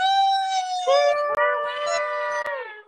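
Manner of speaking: high squealing burst of laughter; loud shrieking laugh. Real, not acted. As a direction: shriek with delight. Big laugh at winning.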